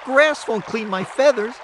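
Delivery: high rooster pleading, rising at the end. A narrator's reading. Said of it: "he asked".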